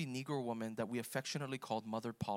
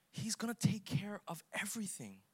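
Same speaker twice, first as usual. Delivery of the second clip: unvoiced hushed whisper; 6 semitones higher than usual. Real, not acted. Preacher whispering the promise to his listeners. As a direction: drop to a secret whisper. Sudden hush after proclamation.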